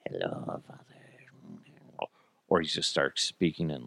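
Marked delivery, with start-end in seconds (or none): 0.1-0.8 soft creepy whispered greeting; eerie whisper. Retold or imitated. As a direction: whisper it like a ghost child. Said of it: "like, humming or something".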